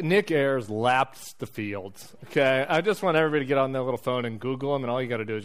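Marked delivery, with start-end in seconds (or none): 0.0-2.0 slow comic emphasis. Real, not acted.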